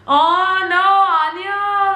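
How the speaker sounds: imitated wail of disagreement; mock protest. Crowd protests his self-criticism.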